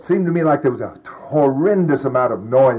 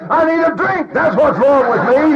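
desperate blurt; 13 semitones higher, 3 dB louder than usual. Retold or imitated. Blurting out his need.

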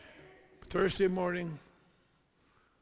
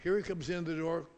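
ordinary talk, nothing marked

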